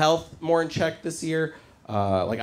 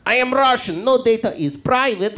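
put-on Russian accent, louder and higher; proud blunt Russian doctor declaring it; 6 semitones higher, 4 dB louder than usual. Doctor boasting to young patient in mock Russian accent. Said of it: "rude Russian doctor".

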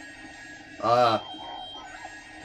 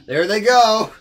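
high, loud rising cheer; whooping play-by-play shout; excited call; 8 semitones higher, 8 dB louder, slower than usual. Real, not acted. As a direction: call it out louder, pitched right up. Repeats it louder and higher.

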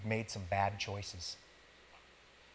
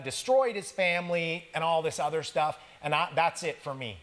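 higher, louder preacher in full swing; preaching; 7 semitones higher, 5 dB louder than usual. Acted. Pastor telling the congregation about a confession, preaching louder. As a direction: drive the story home with rising force.

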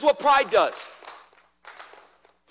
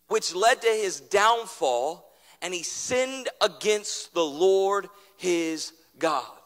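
ordinary delivery

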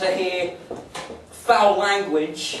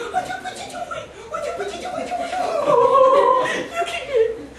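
squeaky tiny voice. Acted. Comic voicing a tiny creature for the crowd, very high squeak.

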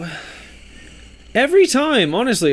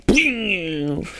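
higher, drawn-out "bling"; imitated launch sound; 4 semitones higher, 3 dB quieter than usual. Spoofing the top's launch, drawn-out sound effect. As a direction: sing out a cartoon launch noise.

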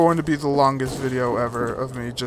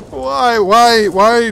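exasperated cry; 6 semitones higher, 9 dB louder, much slower than usual. Real, not acted. Frustrated at own challenge.

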